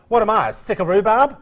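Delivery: mock-offended mother; mother's indignant saying. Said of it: "my mother would say".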